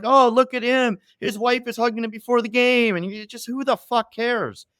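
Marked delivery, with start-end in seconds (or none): 0.0-2.9 higher, gushing mock announcer; mocking ESPN. Mocking ESPN's gushing coverage of Kelce, hammed-up announcer. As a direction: parody a fawning sportscaster. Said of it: "E S P N is like".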